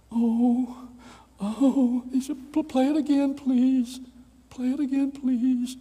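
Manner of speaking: put-on high, loud old man begging; pleading old man.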